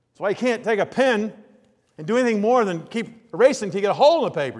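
ordinary talk

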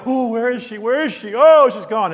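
hammed-up frantic searching cry; mock panic. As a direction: wail it like someone comically lost. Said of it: "I didn't go".

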